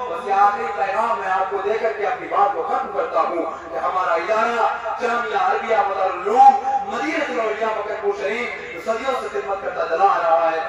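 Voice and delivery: normal talking voice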